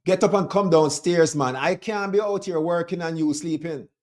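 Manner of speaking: imitating his Jamaican dad calling him downstairs; dad's Jamaican accent. Imitating his Jamaican father calling his son downstairs, a thick Jamaican accent. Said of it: "something that your dad said to you in his accent".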